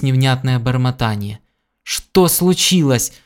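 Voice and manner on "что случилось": slow, higher-pitched, insistent question; alarmed question. A narrator's reading. Gordon repeats his question to his doubled-over friend, alarmed insistence. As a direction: demand an answer in alarm, drawing it out. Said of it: "повторил Гордон".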